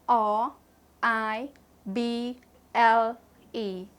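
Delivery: ordinary talk, nothing marked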